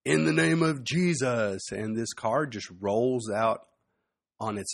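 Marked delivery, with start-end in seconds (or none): 0.0-1.7 mild mock shout, pitched higher; retold shout. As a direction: imitate a shout without full volume. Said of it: "he shouts".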